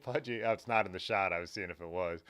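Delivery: usual speaking voice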